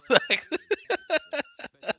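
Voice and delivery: high-pitched, loud fit of giggles; long cackling laugh. Real, not acted. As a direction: burst out in helpless high laughter. Laughing at his own attempt.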